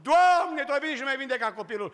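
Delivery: high cry of joy, then rushing on fast; mother's yelled thanks to the Lord; retold shout of joy. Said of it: "Am zbierat cât am putut".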